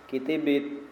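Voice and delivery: "kitibit" with an i in every syllable; wrong vowelling. Shown as a demonstration. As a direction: misread it as "kitibit", all i vowels. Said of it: "jangan dibaca".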